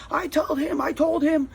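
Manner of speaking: high-pitched mock yell; retold yelling. Neighbor protesting to the landlord, imitated yelling. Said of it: "he's yelling at Fred".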